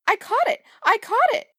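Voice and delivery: very high, gleeful cry; excited squeal. A narrator's reading. Beauty catches the bouquet at the wedding, excited squeal.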